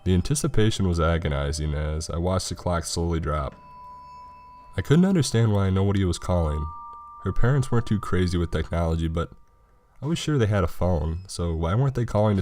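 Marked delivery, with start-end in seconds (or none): none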